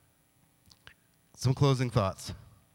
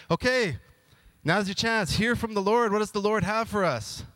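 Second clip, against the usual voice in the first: eager high king; 7 semitones higher than usual. Retold or imitated.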